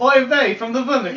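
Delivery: lightly voiced Yiddish accent; a hint of a Yiddish lilt. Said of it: "don't keep doing it".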